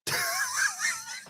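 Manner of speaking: hearty shouted cackle; big shouted laugh. Real, not acted. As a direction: let out a big whooping laugh. Host greets Ando.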